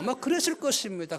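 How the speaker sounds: normal talking voice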